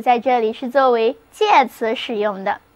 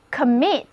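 "commit" stressed on MIT; stress on second syllable. Shown as a demonstration. Teacher models "commit" for learners to repeat, stressing MIT. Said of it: "重音还是放在第二个音节上".

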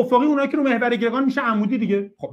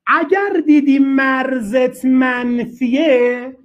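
slow emphasis; much slower than usual. Shown as a demonstration. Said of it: "teacher repeats the rule".

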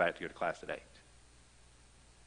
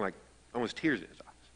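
ordinary talk, nothing marked

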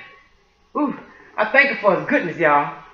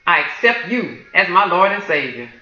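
prayer of salvation. Real, not acted.

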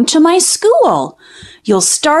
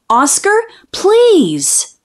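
higher, slow begging please; drawn-out plea; 5 semitones higher, slower than usual. Acted. Child begging her dog to talk, slow pleading. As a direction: draw the please out like a whining child.

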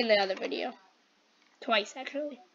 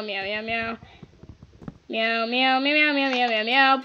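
insistent meowing; 5 dB louder than usual. Pretend. Player keeping others from being heard, insistent meowing. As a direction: meow harder to drown out the talk. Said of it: "Just screaming this in the mic".

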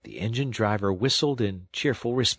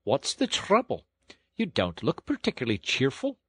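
higher-voiced, concerned engine driver; friendly engine driver; 4 semitones higher than usual. A narrator's reading. Engine driver to a tearful washerwoman, friendly concern. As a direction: ask kindly as a working man.

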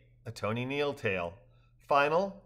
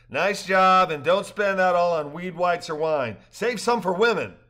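higher, louder hearty tease; jovial rafter; 5 semitones higher, 7 dB louder than usual. A narrator's reading. Tipping the river guide, louder teasing voice. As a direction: rib the guide with a grin.

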